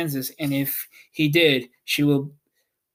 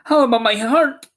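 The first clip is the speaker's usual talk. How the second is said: higher, louder eager question; Tin Woodman asking; 7 semitones higher, 5 dB louder than usual. A narrator's reading. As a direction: press the wizard for a heart. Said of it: "asked the Tin Woodman".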